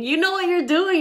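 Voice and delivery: high, loud teasing. Shown as a demonstration.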